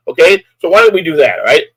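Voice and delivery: ordinary delivery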